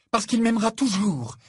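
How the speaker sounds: high, loud, falling retort; defiant retort. A narrator's reading. Fiancée insisting on his lasting love, defiant retort. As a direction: fire it back, pitched well up. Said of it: "répliqua Fleur".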